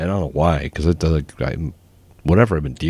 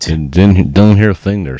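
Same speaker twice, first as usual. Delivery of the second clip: loud mock denial, higher; loud teasing; 4 semitones higher, 9 dB louder than usual. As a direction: boom it out as a joke. Teasing denial, much louder.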